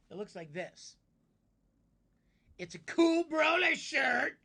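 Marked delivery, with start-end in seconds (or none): 2.6-4.4 loud, slow, high hype about the shirt; hyped shout. Real, not acted. Streamer showing chat his shirt, loud and hyped. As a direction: shout it like a proud fan.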